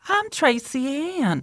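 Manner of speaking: put-on high, slow eerie girl voice; creepy ghost girl voice. Ghost voice, called creepy after.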